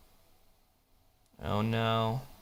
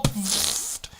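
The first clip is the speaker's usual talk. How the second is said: ordinary delivery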